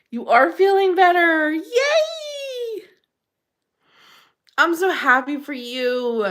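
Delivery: high, loud, laughing yay; happy cheer. Real, not acted. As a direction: cheer her on. Cheering a viewer's recovery.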